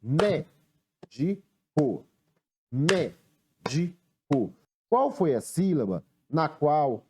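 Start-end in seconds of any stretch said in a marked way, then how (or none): 0.0-2.0 "Médico" broken into three slow beats, Mé-di-co; "Médico" slowly, a gap after every syllable. Shown as a demonstration. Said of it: "A primeira é batendo palmas". 2.7-4.0 "Médico" in three separate clapped beats, Mé-di-co; "Médico" with a pause after each syllable. Shown as a demonstration. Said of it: "A primeira é batendo palmas".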